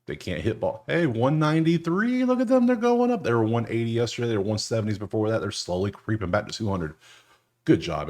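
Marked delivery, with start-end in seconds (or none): 0.9-3.2 high, rising excited cry; excited cheer. Real, not acted. Bettor cheering the Angels' improved average, excited high cheer.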